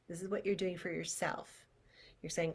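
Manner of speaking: ordinary talk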